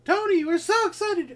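squeaky, loud falsetto cheer; high voice. Acted. Host voicing excited listeners to Tony, high voice. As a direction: imitate the listeners in a silly high pitch. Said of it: "I tried to do a high voice".

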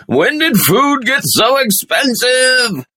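put-on high, slow grumpy old-man shout; old man shaking fist. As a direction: rant at the sky like a cranky old man. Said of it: "I feel like an old man shaking my fist at the sky".

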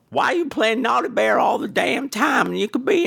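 high, exasperated complaining voice; Brad. Retold or imitated. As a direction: mimic an annoyed partner nagging. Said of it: "I'm driving Brad crazy with it".

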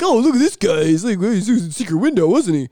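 loud, high-pitched sneering imitation; mocking scoffer. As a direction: jeer at a clichéd story. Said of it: "everybody can sit back and laugh and be like".

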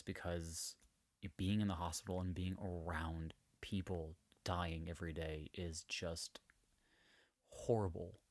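soft, half-whispered, heartbroken; real emotion, voice sinking; getting emotional. Real, not acted. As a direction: let it drop to a near whisper as it gets to you. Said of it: "I'm getting emotional talking about it".

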